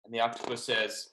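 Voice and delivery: ordinary delivery, nothing marked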